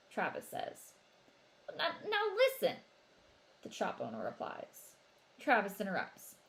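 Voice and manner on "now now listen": nervous backpedal. A narrator's reading.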